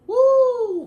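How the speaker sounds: high loud whoop; projecting whoop. Shown as a demonstration. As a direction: let out a big high woo. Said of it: "demo of projection".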